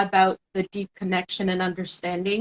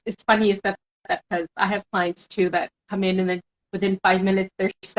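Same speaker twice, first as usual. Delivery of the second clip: ordinary talk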